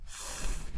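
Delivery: "F" as a long airy fff, no vowel; voiceless phonics fff for "F"; letter sound. Shown as a demonstration. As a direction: hold just the first sound of fish for "F". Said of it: "F sound".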